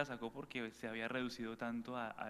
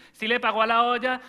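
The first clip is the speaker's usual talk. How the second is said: very high, very loud caricature shout; shrieking grandmother; 12 semitones higher, 19 dB louder than usual. Retold or imitated. Grandmother screams mid-rosary about the pot, very high and loud. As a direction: screech it across the kitchen.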